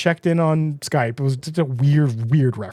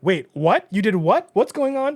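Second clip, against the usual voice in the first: rising, higher disbelief; shocked; 7 semitones higher than usual. Retold or imitated. Regina learning Henry drove unlicensed, shocked. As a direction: climb in pitch with disbelief. Regina hears the illegal driving.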